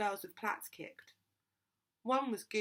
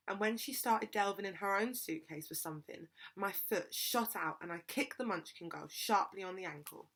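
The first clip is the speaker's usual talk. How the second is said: ordinary delivery, nothing marked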